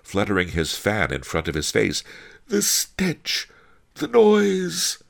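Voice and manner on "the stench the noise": high, overcome exclamations; panting. A narrator's reading. Master Lee wilts in the street, high panting complaint. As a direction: gasp each complaint as if wilting. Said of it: "Master Lee panted".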